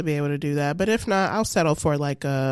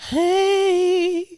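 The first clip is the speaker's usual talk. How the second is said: awkward high greeting; 11 semitones higher, 3 dB louder than usual. Pretend. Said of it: "I'm gonna be like".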